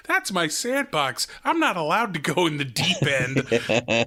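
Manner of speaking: put-on high, earnest little kid voice; imitating Ralphie showing his sandbox. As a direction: play it like a proud small boy.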